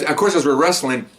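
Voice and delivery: ordinary delivery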